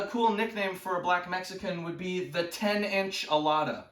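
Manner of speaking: usual speaking voice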